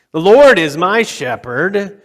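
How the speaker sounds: loud proclamation, Lord punched; proclaiming. Real, not acted. Preacher proclaiming the psalm, loud and emphatic. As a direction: declare it to the room.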